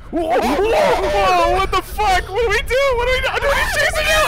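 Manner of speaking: high, loud panicked yelling; panicked screaming. Real, not acted. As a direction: scream in terror. Chased by monster, screaming.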